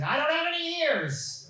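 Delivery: high, drawn-out protest; very high, stretched comic complaint; exasperated mock yell. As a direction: wail it slowly in exaggerated indignation. Acted patient's exasperated protest.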